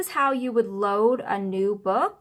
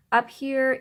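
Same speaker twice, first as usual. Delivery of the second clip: ordinary talk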